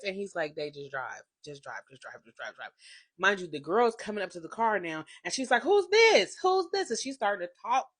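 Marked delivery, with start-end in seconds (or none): 0.6-2.7 put-on low, soft drunk mumble, fading; imitating drunk mumble. 5.6-6.9 high, loud mock "Who's this?"; mock demanding woman.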